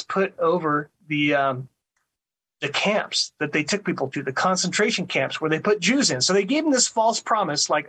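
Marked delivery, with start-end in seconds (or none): none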